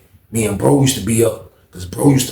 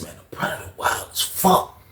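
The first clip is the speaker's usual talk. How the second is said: whisper; 6 semitones higher, 7 dB quieter than usual. Retold or imitated. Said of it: "I said".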